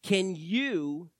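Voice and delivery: ordinary delivery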